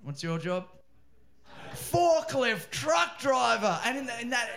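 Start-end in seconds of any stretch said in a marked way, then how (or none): 1.7-3.8 put-on high, slow shout in a Cheshire accent; mock Cheshire accent. Comic echoes the lad's job in a mock Cheshire accent. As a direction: belt it out slowly, relishing the Cheshire accent. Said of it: "in that accent, it's perfect".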